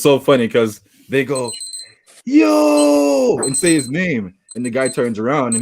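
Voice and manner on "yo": long, high shouted holler; mock calling out. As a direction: imitate hailing someone across a street. Friends calling out to a player.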